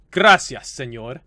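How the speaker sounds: loud, hammed-up Spanish accent; put-on Spanish accent. Shown as a demonstration. Gamer showing off their Spanish, put-on Spanish accent. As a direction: lay the Spanish accent on thick. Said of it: "I just like putting the accent onto it".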